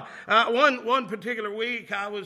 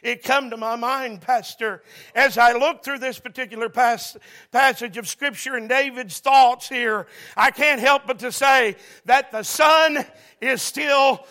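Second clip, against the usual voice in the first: loud, rising sermon cadence with shouted peaks; full-force preaching; 3 semitones higher, 10 dB louder than usual. Acted. Preacher rousing the church with the plane parable, full-throated preaching. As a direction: preach it home, building the congregation up.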